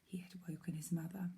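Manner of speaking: ordinary delivery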